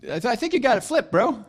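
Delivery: high, quick, hesitant reply; mock-timid casual retort; sheepish comeback. Own nervous reply to the warlock.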